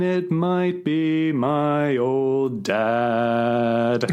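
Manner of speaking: drawn-out, very slow last line; very slow, lingering punchline; slow finish. Acted. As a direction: stretch it out for the ending. Slowed final line of song.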